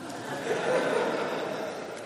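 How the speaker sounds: breathy quiet laugh at his own punchline; soft laugh. Real, not acted. Preacher laughing softly at his own joke, a breathy quiet laugh. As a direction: let a soft laugh slip out.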